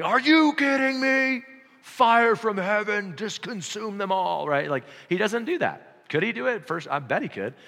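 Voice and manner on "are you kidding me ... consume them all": much higher, louder and slow, a hammed-up wrathful voice; mock furious Jesus. Preacher imagining Jesus annoyed at crowds: much higher, slow, hammed-up fury. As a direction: call down fire in exaggerated, slow fury. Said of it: "he's not going".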